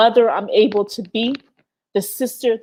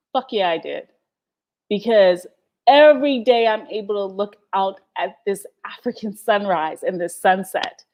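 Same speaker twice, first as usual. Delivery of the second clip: ordinary delivery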